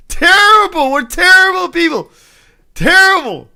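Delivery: high, loud, laughing mock outrage. Real, not acted. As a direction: scold yourself through laughter. Laughing at his own dirty joke.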